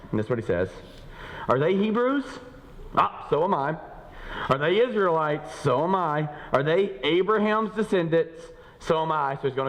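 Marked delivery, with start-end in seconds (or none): 1.5-9.3 Paul boasting, half defiant; emphatic scripture reading. Acted. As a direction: hurl each question, answer proudly.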